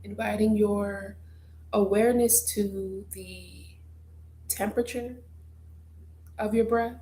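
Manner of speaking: guided meditation. Acted. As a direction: guide listeners gently through their breath, leaving space. Leads a breathing meditation.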